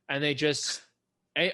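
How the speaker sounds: ordinary talk, nothing marked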